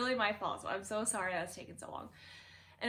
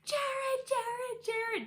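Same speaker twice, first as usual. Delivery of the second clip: retold screaming; 14 semitones higher, much slower than usual. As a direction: yell for Jared from the other room in alarm. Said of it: "I just start screaming".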